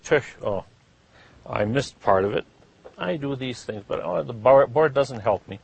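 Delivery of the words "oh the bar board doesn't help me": usual speaking voice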